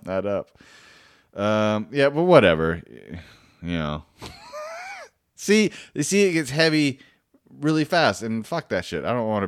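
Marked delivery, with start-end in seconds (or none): 4.5-5.0 burst of laughter. Real, not acted. Laughing at own joke.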